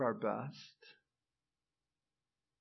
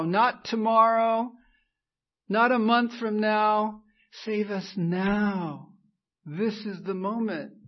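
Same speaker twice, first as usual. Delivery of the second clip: louder pleading sermon cry; fervent loud preaching; 6 semitones higher, 9 dB louder than usual. Real, not acted. Pastor voicing the crowd's plea, loud and fervent. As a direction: beg for rescue now, at full voice.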